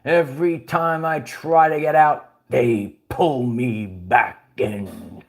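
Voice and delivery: lightly voiced gangster, dropping low and slow at the end; a hint of a weary mob boss; mock gangster lament, slow and low; mobster movie quote. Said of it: "Remember that one?".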